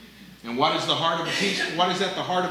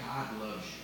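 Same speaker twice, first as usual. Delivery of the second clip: much softer and a bit lower, gentle; soft God loves you; 7 semitones lower, 11 dB quieter than usual. Shown as a demonstration. Pastor contrasting gospel delivery for the church, soft and low. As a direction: say it softly, almost under the breath. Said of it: "Or we can say".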